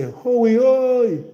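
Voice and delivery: high, loud holler with a drawn-out OI; demonstrated rural Jamaican call. Shown as a demonstration. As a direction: hail your cousin across the yard. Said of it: "me say".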